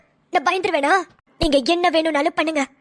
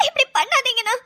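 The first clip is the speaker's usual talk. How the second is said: tearful plea; 5 semitones higher than usual. Acted. Pleads with brother, crying.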